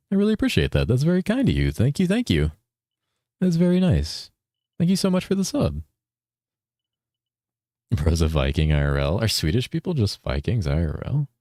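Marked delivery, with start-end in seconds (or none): 0.1-5.8 high-pitched grateful voice; sweetly appreciative, pitched well up; gushing thanks. Real, not acted. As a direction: gush your thanks up high. Thanking viewer for subscription.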